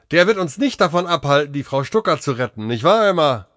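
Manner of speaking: fast, high-pitched confident rallying; rally your companion briskly; spirited determination. Acted. Confident doctor talks to Emma.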